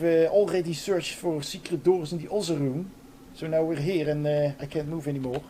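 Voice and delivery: hammed-up Dutch-accented English; English as a hopelessly Dutch speaker would say it; horrible Dutch accent. Shown as a demonstration. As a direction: lay the Dutch accent on thick, with lots of uhs. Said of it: "That was the, uh, horrible Dutch accent".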